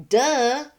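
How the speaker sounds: high, loud mocking "Duh"; sassy duh. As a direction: toss off a scornful duh. Mocking quoted post.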